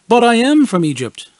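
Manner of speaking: high, loud fib; mock lie. Narrator fibbing to the kids on the radio, high and loud. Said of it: "I lied".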